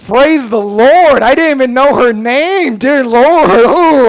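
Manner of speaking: loud, high ecstatic praise; overjoyed praise. Acted. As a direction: shout it like a churchgoer blessed by a miracle. Overjoyed churchgoer exclaiming.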